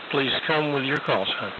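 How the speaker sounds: usual speaking voice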